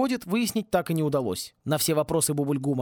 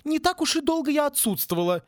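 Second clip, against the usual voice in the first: fierce retort; 12 semitones higher than usual. A narrator's reading. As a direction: bite back at the rector. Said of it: "свирепо возразила Сьюзан". (свирепо возражает ректору)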